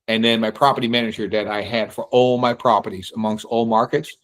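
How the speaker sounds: normal talking voice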